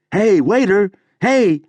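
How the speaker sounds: high, loud call for the waiter; loud high hail. Acted. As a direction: flag down a waiter across a club. Patron calling for the waiter.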